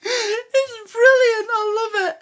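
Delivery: high voice squeezed by laughter; real helpless laughter. Real, not acted. Praising the show after the impression, helpless with real laughter. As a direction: barely get it out for laughing.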